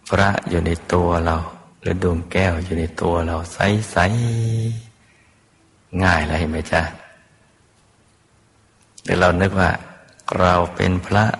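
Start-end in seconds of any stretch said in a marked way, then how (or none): 1.8-6.0 drawn out slowly. Acted. Drawing out image in meditation.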